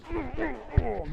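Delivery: high-pitched werebear grunts of pain. Acted. Game master as the hammered werebear, pained grunting. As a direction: grunt like a beast taking blows. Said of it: "reactions of the werebear as it goes".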